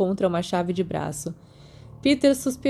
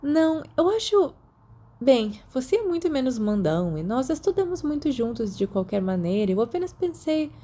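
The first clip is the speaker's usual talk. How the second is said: put-on girl's voice, much higher, hesitant; embarrassed schoolgirl stumbling for words; flustered Lily; 6 semitones higher than usual. A narrator's reading. (menina envergonhada, voz bem mais aguda e hesitante)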